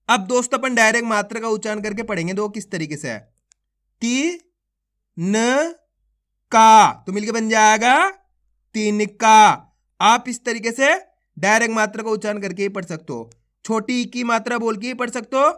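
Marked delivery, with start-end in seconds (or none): 4.0-9.4 stretched-out syllable calling, each syllable punched; phonics drill. Shown as a demonstration. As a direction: sound out each syllable slowly for children, then join them. Sounding out syllables into words.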